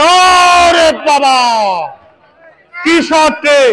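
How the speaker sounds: slow, stretched shout of amazement; amazed shout. Real, not acted. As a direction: gasp it out at the shot. Amazed at a big shot.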